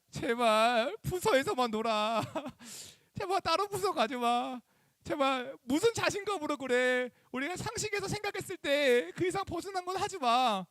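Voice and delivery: put-on high, slow pleading with the kids. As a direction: beg them, stretching out 제발. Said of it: "막 이렇게 이야기했거든요". (아이들에게 높고 느리게 애원하듯)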